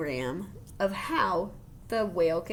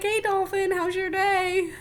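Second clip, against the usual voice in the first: much higher, louder, friendly call; sing-song hello to a dolphin; greeting a dolphin; 11 semitones higher, 6 dB louder, slower than usual. Pretend. Pretend child greeting a dolphin, cheerful high call. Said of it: "Be like".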